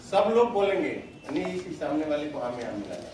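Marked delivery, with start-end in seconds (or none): none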